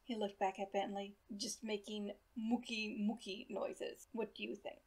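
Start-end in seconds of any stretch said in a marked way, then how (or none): 1.4-4.8 put-on slow, softer German-accented musing; thick accent. A narrator's reading. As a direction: trail into a soft question, German accent. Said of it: "in his thick accent".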